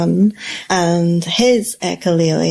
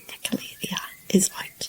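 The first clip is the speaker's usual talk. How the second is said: soft unvoiced whisper of the repeated words; murmured echolalia, barely audible; 11 dB quieter than usual. Real, not acted. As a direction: breathe the words out with no voice.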